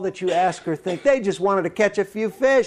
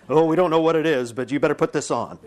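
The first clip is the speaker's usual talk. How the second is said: quick, offhand nurse with a laugh; hurried hospital staff; 5 semitones lower, faster than usual. Retold or imitated. Preacher voicing hospital staff, quick and offhand. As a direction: rattle off the warning like busy staff.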